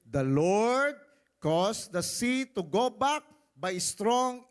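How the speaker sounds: loud, slow proclaimed verse; preacher booming the Exodus passage; dramatic scripture reading. Acted. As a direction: read it like a mighty miracle. Reads scripture with force.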